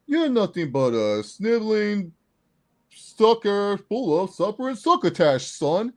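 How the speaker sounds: slow, high, loud cartoonish taunt; Roman Reigns. Fan imitating early wrestler's insult, hammed-up slow taunt. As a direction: draw out the silly insult like an old promo. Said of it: "the Roman Reigns when he was like".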